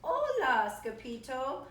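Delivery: loud, high storybook hello; excited greeting. A narrator's reading. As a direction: call out to an old amigo. Old amigos greet the hero.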